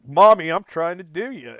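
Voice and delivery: hammy mock come-on; creepy son voice. Son voiced in retold scene.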